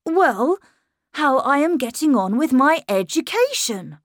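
put-on a boy insisting he's grown. A narrator's reading. Miles telling his governess he's growing up.

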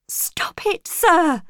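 sharp protest rising loud and high; crying out. A narrator's reading. Jane pulls away from his kiss, crying out. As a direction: cry out in acted alarm. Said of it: "I cried".

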